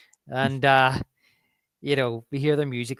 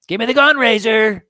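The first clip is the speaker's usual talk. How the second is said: loud, high order; forceful command; 8 semitones higher, 9 dB louder than usual. Retold or imitated. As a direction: demand the gun, no arguing. Retold command seizing a gun.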